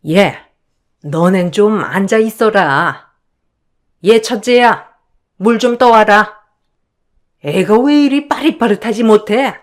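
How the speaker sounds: imperious, nagging in-law voice; bossy mother-in-law. Acted. Mother-in-law sends the eldest for water, bossy scolding. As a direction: order the eldest daughter-in-law about.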